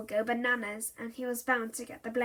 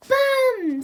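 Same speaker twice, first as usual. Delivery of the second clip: much higher and louder; gleeful toddler shout; 13 semitones higher, 10 dB louder than usual. Acted. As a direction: yell it proudly. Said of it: "said Molly".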